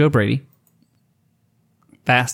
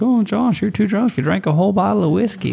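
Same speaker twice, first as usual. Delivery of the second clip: mock scolding; 7 semitones higher than usual. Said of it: "I was like".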